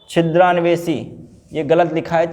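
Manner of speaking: ordinary talk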